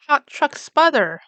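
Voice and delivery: plain reading voice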